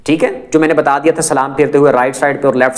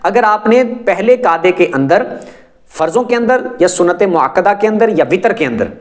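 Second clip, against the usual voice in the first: ordinary talk, nothing marked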